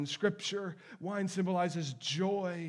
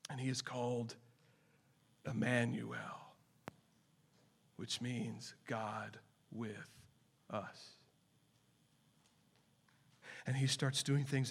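hushed preaching; 5 semitones lower, 3 dB quieter than usual. Acted. Preacher telling of Jesus' deeds, hushed and reverent. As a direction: draw them in quietly and reverently.

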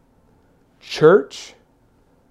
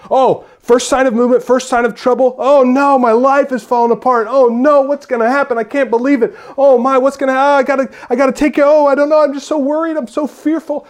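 high, loud, frantic worrying; hammed-up fearful heart, much higher and louder; mock panic; 10 semitones higher, 8 dB louder than usual. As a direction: whine like a panicking worrier. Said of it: "That's a flappable heart".

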